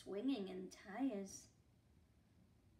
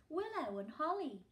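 usual speaking voice

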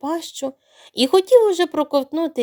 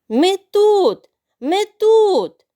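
much higher, louder cries for help; shouting; 8 semitones higher, 6 dB louder, much slower than usual. Acted. Brothers shouting from the crocodile's belly. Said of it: "разом закричали".

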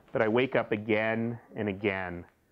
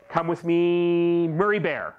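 tender to teddy; 4 semitones higher, 4 dB louder, slower than usual. Acted. Child voicing to teddy.

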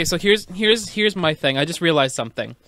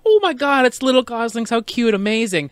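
gushing animals; 6 semitones higher than usual. Retold or imitated. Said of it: "all the other animals are".

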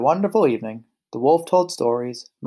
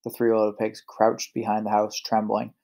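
ordinary delivery, nothing marked

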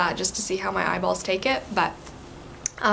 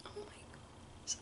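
whisper; 23 dB quieter, slower than usual. Real, not acted. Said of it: "literally an emotional wreck".

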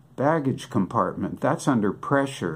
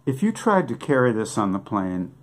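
ordinary delivery

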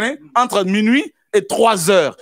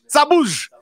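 full-force preaching; 6 semitones higher than usual. Acted. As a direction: preach it out, rousing the church.

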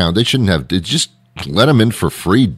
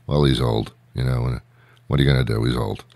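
low, soft shrugging mutter; mumbled excuse; 7 semitones lower, 6 dB quieter, faster than usual. Retold or imitated. Bystanders shrug off an old man's quirks, low soft mutter. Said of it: "people just excuse you and say".

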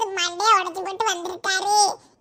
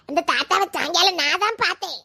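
ordinary talk, nothing marked

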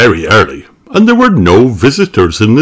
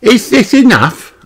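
put-on high little-girl voice; Peppa. A narrator's reading. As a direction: ask high like a small child. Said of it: "asked Peppa".

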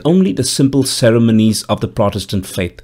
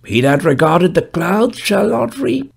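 put-on old gravedigger voice; 9 semitones higher than usual. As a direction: speak as a dour old gravedigger, raised pitch. Gravedigger speaks in story.

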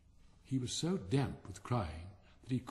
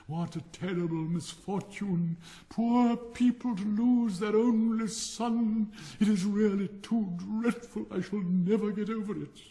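melodramatic lament; 8 semitones higher, 5 dB louder than usual. Acted. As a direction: wail over a tragedy that never happened. Rocket's theatrical lament.

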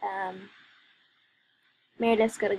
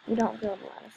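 ordinary delivery

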